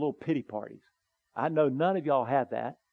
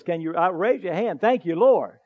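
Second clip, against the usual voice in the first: usual speaking voice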